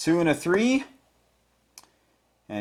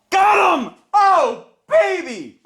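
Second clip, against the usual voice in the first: high, loud shout of triumph; overjoyed high-pitched cheer; real excited yell; 5 dB louder, slower than usual. Real, not acted. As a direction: yell it out, thrilled at the winning hit. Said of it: "sorry for yelling on YouTube".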